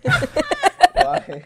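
high giggly squeak through laughter; laughing nervously mid-question. Real, not acted. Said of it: "Your nervous laugh".

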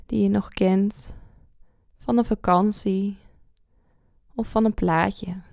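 calm guide with long pauses; guided meditation. Acted.

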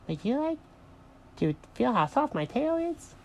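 tiny, high, soft voice; small high-pitched cartoon creature; little bunny. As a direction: ask softly like a baby rabbit. Little bunny asks shyly.

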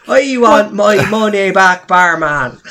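high, slow, loud indignant complaint; impression of Walshy. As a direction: demand your money back, hammed up. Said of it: "bad impression of Walshy Mark just did".

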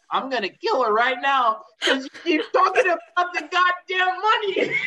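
fed-up rant that breaks into laughter; exasperated outburst. Retold or imitated. Director retelling his fed-up threat to the actress, loud laughing rant.

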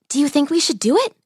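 ordinary delivery

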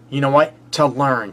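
usual speaking voice

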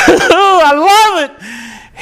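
laughing, high and slow delight. Real, not acted. Preacher delighting in Jesus as mediator, laughing. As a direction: break into a pleased laugh as you say it. Delighted by his own point.

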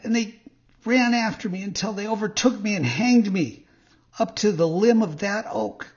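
high-pitched little marionette voice; puppet. A narrator's reading. Puppet continues his tale, high puppet voice. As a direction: carry on as the puppet, pitched well up.

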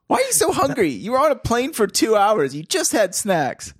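high-pitched exasperated telling-off; mock parental scolding. Dad scolds his hungry sons on the job, mock exasperation.

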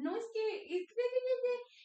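put-on very high little-child babble; high-pitched, stammering small child; toddler voice. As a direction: imitate a toddler protesting in baby talk. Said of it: "y Marian".

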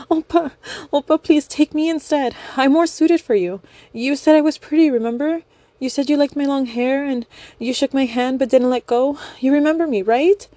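high, tearful begging fangirl; high crying plea. Acted. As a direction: plead through tears, high. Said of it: "still holding the knife and crying".

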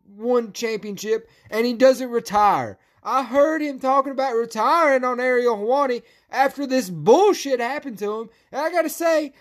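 worked-up, high-pitched angry venting; yelled rant. Real, not acted. Podcaster ranting that Brendan deserved the contract, heated yelling. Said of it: "Sorry about yelling".

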